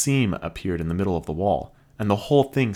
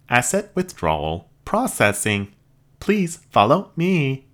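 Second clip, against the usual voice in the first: high, even robot voice; polite machine receptionist; 6 semitones higher, 4 dB louder than usual. Acted. Robot leads.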